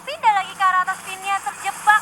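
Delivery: play-by-play. Real, not acted. Fight play-by-play.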